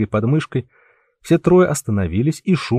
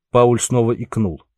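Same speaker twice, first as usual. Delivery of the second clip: plain reading voice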